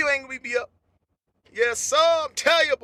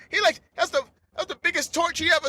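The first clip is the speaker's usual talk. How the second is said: ordinary delivery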